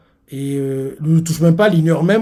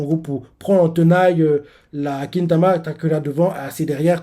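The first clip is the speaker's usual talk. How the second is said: usual speaking voice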